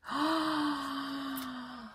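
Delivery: a sharp, high gasp of delight; dramatic gasp. Real, not acted. Reading tarot cards to viewers, a dramatic gasp. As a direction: gasp as if a card took your breath. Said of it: "Pardon my dramatic reactions".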